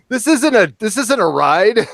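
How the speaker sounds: high, loud, indignant cartoon whine; indignant cartoon character. Host imitating a SpongeBob character, high and indignant. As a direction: squeal it out like an outraged cartoon dupe.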